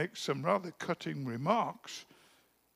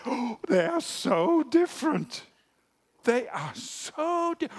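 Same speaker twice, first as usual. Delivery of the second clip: high, breathless gushing with gasps; mock gushing wedding guest; 7 semitones higher than usual. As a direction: ham up a starry-eyed guest at the wedding.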